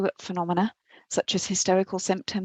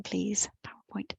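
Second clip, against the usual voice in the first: softer, whispered plea to PowerPoint; whisper to the computer; 8 dB quieter than usual. Real, not acted. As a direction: whisper please, PowerPoint under her breath. Coaxing the slideshow under her breath.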